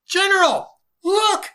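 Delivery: very high, full-force shout; alarmed yell. Acted. Soldier alerting his general to something overhead, yelling. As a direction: yell it across the mountainside. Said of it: "yelled a soldier".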